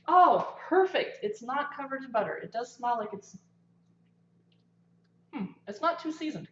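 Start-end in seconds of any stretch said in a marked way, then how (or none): 0.1-1.2 relieved delight. Real, not acted. Relief the corn isn't buttery.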